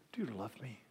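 hushed, searching question; very soft, breath-only asking; whispered question. Acted. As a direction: ask it tenderly under your breath. Jesus gently asking a third time.

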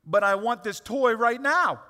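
loud, slow mock five-year-old whine; whiny child. Preacher imitating a five-year-old demanding a toy, whiny. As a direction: whine like a kid wanting a toy. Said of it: "They're gonna be like".

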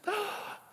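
high, soft delighted gasp; mock gasp. Church member delighted by the pastor, gasping. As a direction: gasp like a charmed Southern lady. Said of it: "Oh, Pastor".

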